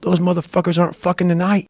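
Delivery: usual speaking voice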